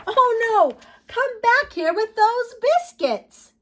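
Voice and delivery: high, loud mock alarm; calling out. Little girl chasing her puppy, loud high call. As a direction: shout after a puppy stealing biscuits.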